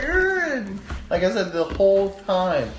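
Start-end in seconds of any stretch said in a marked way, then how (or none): 0.0-0.8 high-pitched imitated kid wail; loud, drawn-out mock crying. Imitating scared cousin on the ride, wailing. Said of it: "he's just like".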